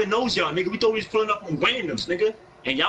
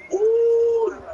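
excited squeal; 5 dB louder than usual. Real, not acted. Streamer reacts to a new sub.

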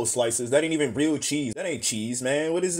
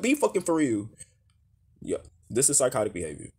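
ordinary talk

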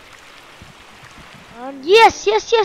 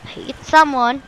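ordinary talk, nothing marked

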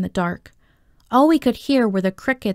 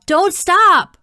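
loud, high-pitched shout; urgent yell; 10 semitones higher, 5 dB louder than usual. A narrator's reading. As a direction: yell it to keep a runner going. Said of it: "would yell".

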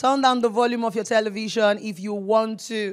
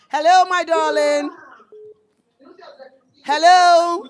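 higher, louder, slow hello down the phone line; louder stretched greeting to a silent caller; calling out; 6 semitones higher, 6 dB louder, slower than usual. Real, not acted. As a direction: call out to a caller who can't hear you.

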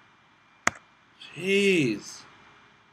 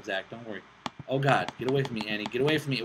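usual speaking voice

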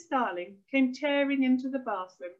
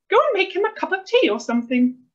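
high, loud order; cross wife; 8 semitones higher, 8 dB louder than usual. A narrator's reading. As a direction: boss your husband about irritably. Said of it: "hissed his wife".

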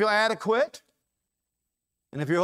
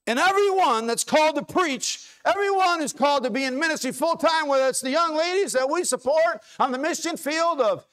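high, loud pulpit shout; preacher rallying everyone who is called; full-force preaching; 9 semitones higher, 5 dB louder than usual. Acted. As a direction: lift it up and proclaim it.

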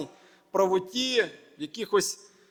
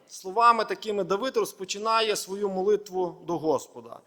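ordinary talk

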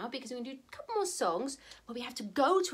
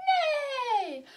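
high, falling whinny; horse neigh; 11 semitones higher, 3 dB louder than usual. Acted. Showing children the horse's sound, neighing. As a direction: neigh high and let it slide down. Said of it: "he makes a sound like this".

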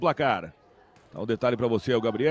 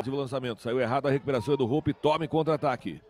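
usual speaking voice

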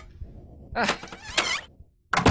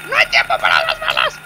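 much lower, loud put-on voice; mock dramatic shout; 10 semitones lower, 15 dB louder than usual. Gamer hamming it up, refusing to grab the bullets. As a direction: boom it like a hammed-up action hero.

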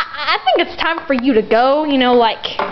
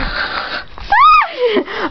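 mocking laugh; 12 semitones higher than usual. Mocking laugh after the insult.

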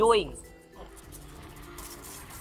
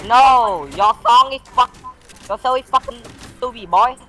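loud, higher complaint; annoyed outburst; 5 semitones higher, 6 dB louder than usual. Real, not acted. Gamer complains about the broken microphone, annoyed outburst. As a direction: protest loudly at a bad sound.

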